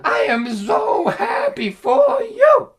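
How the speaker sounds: high, loud, slow mocking cry of joy; dad mocking son's easy life with wailing sarcasm; mock sarcastic dad. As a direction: sneer it as fake delight. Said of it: "He goes".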